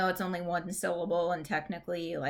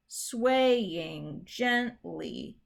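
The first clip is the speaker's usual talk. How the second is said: "swaying gently" very slow, SWAY and GENT stressed; drawn-out, exaggerated stresses in "swaying gently"; stressed-syllable demo; much slower than usual. Shown as a demonstration. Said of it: "words in there that are more than one syllable".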